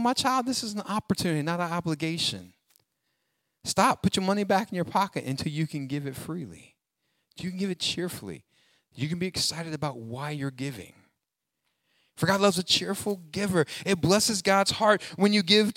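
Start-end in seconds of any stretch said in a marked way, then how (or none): 0.0-10.9 soft, gentle fatherly reassurance; tender God voice. Pretend. God gently speaking to a reluctant giver, soft and tender. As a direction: speak softly like a loving father. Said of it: "He's looking at you going".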